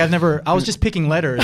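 a hint of a chirpy six-year-old; lightly voiced innocent daughter; innocent kid.

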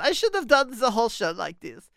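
normal talking voice

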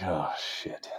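a dismayed curse under the breath; barely voiced oh shit; hushed curse. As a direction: swear softly without being heard. Bull moose runs off.

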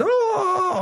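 high, thin gnome wail; mock weeping wail. Game master plays a gnome on the ground, high mock weeping. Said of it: "on the ground weeping".

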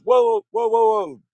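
loud, high whoa whoa; alarmed shout. Retold or imitated. Father stopping the mother, loud and alarmed. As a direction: cut in to stop her. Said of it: "my dad goes".

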